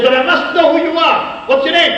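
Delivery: put-on high, eager voice of a frail old man; pleading old man. Rescued old man begs his savior for his name, high and eager. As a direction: beg to know who saved him.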